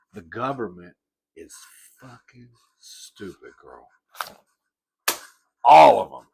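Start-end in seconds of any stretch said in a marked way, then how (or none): none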